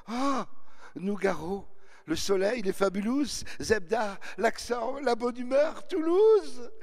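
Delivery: high, loud, swooning praise of Toulouse; gushing girl. Retold or imitated. A girl gushing to the narrator about Toulouse, swooning. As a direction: gush like a starry-eyed fan.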